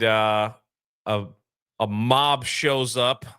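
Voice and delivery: usual speaking voice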